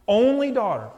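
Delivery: only pushed up high and loud; stress on only. Acted. Preacher stressing the father's only daughter. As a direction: lean hard on only.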